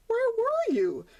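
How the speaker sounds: high, worried mother's question; mother donkey. Acted. Mother donkey questions Sonny on his return, high and worried. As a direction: ask like a relieved anxious mother. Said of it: "his mother asked".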